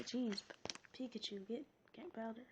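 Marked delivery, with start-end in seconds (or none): none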